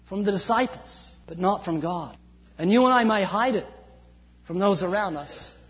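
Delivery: usual speaking voice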